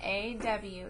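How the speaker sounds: usual speaking voice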